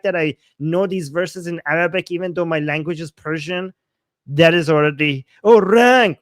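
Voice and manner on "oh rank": loud, higher-pitched jeer; mock correction. Mimicking viewers who correct the recitation, loud mock correction. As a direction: imitate a critic pouncing on a mistake.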